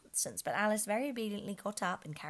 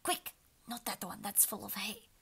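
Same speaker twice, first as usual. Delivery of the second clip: soft, hurried king voice; hushed king; 3 semitones higher, 5 dB quieter than usual. A narrator's reading. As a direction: keep it hushed and quick. King still whispering.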